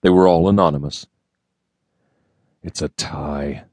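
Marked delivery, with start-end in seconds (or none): 2.6-3.7 much softer and slow, under his breath; muttered. A narrator's reading. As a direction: grumble it to himself, slow and soft. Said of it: "Kilraven muttered".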